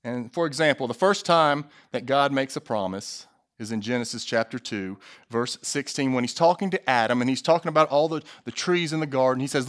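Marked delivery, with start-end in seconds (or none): none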